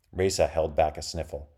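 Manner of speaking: ordinary delivery, nothing marked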